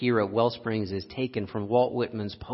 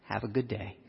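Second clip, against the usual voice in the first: quiet dismissive farewell; 3 semitones lower, 8 dB quieter than usual. Retold or imitated. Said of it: "And I said".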